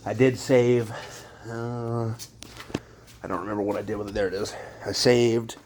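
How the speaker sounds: usual speaking voice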